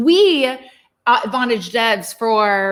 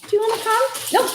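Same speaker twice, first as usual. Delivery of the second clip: talking to a pet; 13 semitones higher, much faster than usual. Real, not acted. Calling the dog to come.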